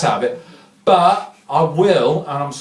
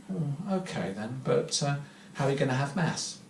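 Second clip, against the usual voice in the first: meek particle; 3 semitones lower, 14 dB quieter than usual. Retold or imitated. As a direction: deliver the pun quietly and innocently. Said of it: "the little Higgs boson says".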